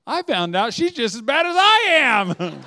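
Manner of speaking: loud, slow, laughing delight; beaming, laughing. Retold or imitated. Lady telling Todd her good news, laughing. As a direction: glow with relieved glee. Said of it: "she beams this huge smile".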